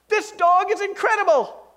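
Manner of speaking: high, loud, incredulous squeal on "Ten bucks"; can't believe the bargain; astonished buyer. Acted. Buyer stunned by the farmer's price, squealing high in disbelief.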